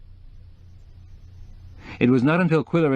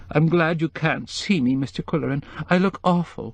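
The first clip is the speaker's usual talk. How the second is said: high, tearful voice; crying again; 7 semitones higher than usual. A narrator's reading. Tearful woman apologizing to Quillerin on the phone. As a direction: cry through the words to him. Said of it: "The tears started again".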